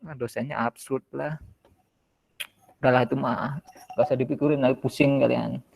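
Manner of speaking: ordinary talk, nothing marked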